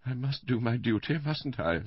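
high, hesitant martyr's voice; Missus Bland, sweetly brave. A narrator's reading. Invalid wife agreeing to do her duty, sweetly brave. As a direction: put on sweet, brave reluctance. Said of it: "with a sweet, brave smile".

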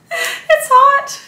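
high, loud laugh through the words. Real, not acted. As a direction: burst into laughter while saying it. Laughs about desert heat.

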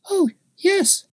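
put-on high child's voice; little girl. A narrator's reading. Little girl answers the man, high child voice.

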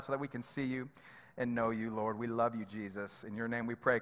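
low, hushed prayer voice; low-pitched, unforced plea to God. Real, not acted. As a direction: pray softly and intimately to God. Leads the congregation in prayer.

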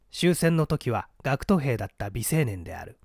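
ordinary delivery

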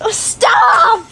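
high, drawn-out protest; exasperated cry. Real, not acted. Child telling sister to stop burping, exasperated cry.

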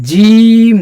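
জিম called out high and loud, drawn out; clear recitation. Shown as a demonstration. Teacher reciting জিম loudly for students to repeat. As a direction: project জিম like a Quran recitation. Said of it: "এই হরফটা বলবেন আর কি".

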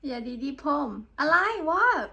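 high, loud puzzled question; what on earth was that; baffled. Real, not acted. Host baffled by garbled phrase. (งงเสียงสูง)

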